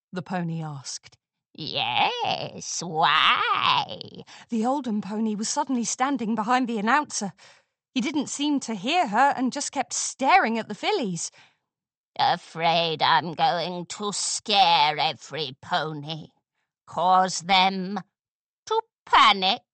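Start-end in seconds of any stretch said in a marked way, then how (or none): 1.5-4.2 low, sneering hag echo; mocking crone. A narrator's reading. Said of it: "Olden pony echoes the question". 12.2-19.6 low, slow taunting hag; sinister crone. A narrator's reading. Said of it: "Olden pony taunts them".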